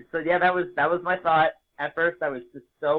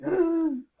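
mock gasp. Reacting to the dream.

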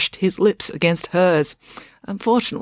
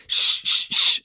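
hushing shh; slower than usual. Acted. Ingrid hushes Dad.

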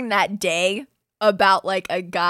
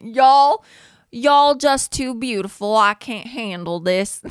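put-on bolder Southern accent, higher and louder on the start; redoing Hall's Southern accent, a bit stronger; Southern accent, second try; 3 semitones higher, 8 dB louder, slower than usual. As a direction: try the Southern accent again, pushing it more. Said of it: "I need to work on it".

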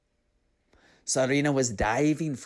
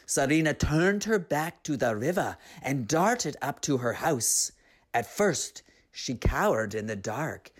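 ordinary delivery, nothing marked

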